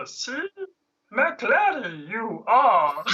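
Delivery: high-pitched little alien sage; creaky Kermit-like puppet voice; Yoda. Pretend. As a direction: squeak it out as a wise puppet. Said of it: "can you do Yoda?".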